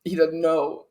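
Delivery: role-played anguish; acted distress. Player acts torn over stabbing friend.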